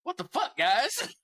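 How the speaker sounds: high, incredulous what the fuck; exasperated disbelief. Retold or imitated. Told to take the drunk girl home, answers in high disbelief. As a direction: throw it back at them in disbelief.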